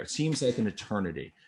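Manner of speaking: ordinary talk, nothing marked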